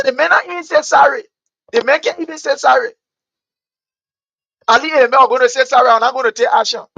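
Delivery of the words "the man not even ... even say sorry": mocking quote. Mocking what Liberians always say.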